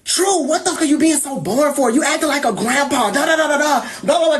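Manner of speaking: imitating a drunk friend teasing; mocking drunk friend. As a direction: needle her like a pushy drunk friend. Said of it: "just antagonizing me".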